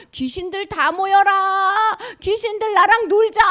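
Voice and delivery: 높고 크게 천천히 부르는 귀신왕; playful ghost king. Pretend. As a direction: call the ghosts high, loud and slow. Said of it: "이러지". (높고 크게 느리게 부르는 귀신왕)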